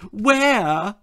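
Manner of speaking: high, eager yelp; a hopeful little yelp. Acted. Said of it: "yelped the much-gulled Angela".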